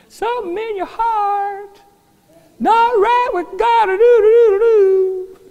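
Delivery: words crowed high and drawn out; rooster crow. Acted. Rooster voicing conviction in the heart, crowing. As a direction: stretch the words into a rooster's call. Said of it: "like that little rooster crowing".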